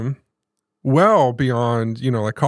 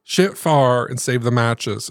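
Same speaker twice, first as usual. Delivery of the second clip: Grandma's Southern saying; slower than usual. Retold or imitated. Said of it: "She would always say that".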